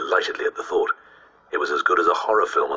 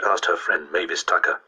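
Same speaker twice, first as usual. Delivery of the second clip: ordinary delivery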